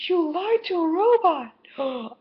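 high, slow, cutesy character voice; quoting the TV character in a sweet high squeak; imitating Cat. Quoting Cat from the show she's watching, high and cutesy. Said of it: "Cat is like".

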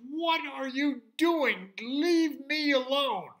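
slow, very loud, high outraged yell; angry pig yell. Acted. Poked pig yelling at the unicorn, slow and loud. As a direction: bellow it out, fed up and poked. Pig jolted awake by a poke.

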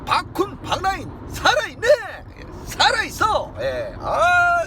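high, loud hype cheer; excited shout. Real, not acted. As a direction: shout it to pump up the crowd. Hyping up the group.

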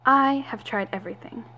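normal talking voice

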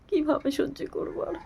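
on the edge of tears, pleading; a mother breaking down, begging for help; tearful despair. Real, not acted. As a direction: voice pitched high and strained with grief.

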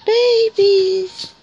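high, sing-song coo at the newborns. Real, not acted. Owner cooing at the newborn babies, high baby talk.

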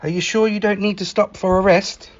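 plain reading voice

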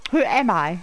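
ordinary talk, nothing marked

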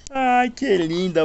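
high, slow doting coo. Acted. Owner coos at his dog lying in the dirt, high and slow. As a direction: melt over how cute she is.

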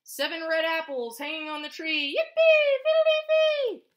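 big bright storybook chant, shouted yippee; gleeful refrain. A narrator's reading. Teacher reading to preschoolers, loud gleeful chant.

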